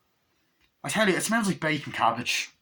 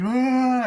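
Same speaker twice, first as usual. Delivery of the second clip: high yelling shriek; Chucky scream; 12 semitones higher, 6 dB louder than usual. Retold or imitated. Retelling the movie, Chucky's high scream. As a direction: shriek like the doll in pain.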